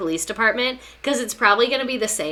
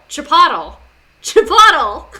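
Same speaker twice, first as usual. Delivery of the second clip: mock shout; 6 semitones higher, 12 dB louder than usual. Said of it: "escalating mock shout".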